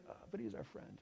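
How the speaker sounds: whispered, hushed aside; whispered realization to himself. Retold or imitated. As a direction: breathe the thought out in a whisper. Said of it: "it just occurred to me like".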